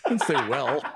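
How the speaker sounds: words through laughter; laughing. Real, not acted. Co-host laughing while defending herself. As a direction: still giggling.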